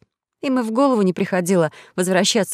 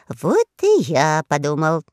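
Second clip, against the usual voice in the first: rat voice; 3 semitones lower, slower than usual. A narrator's reading. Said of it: "ответил крыс".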